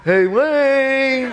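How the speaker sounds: high, drawn-out nagging call; Mike. Retold or imitated. Friend outside the door at night, calling. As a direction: sing out the name through a door. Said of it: "And it's Mike".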